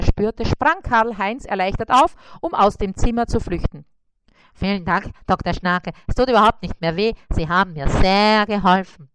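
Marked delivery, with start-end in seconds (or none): 4.5-9.1 louder, quick relieved spider; relieved thanks. Acted. Spider thanks the dentist in a relieved rush.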